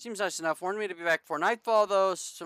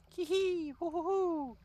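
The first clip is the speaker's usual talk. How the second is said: high giggle; slower than usual. Acted. Gleeful giggle at the boat.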